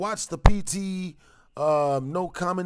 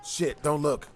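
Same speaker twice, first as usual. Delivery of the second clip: usual speaking voice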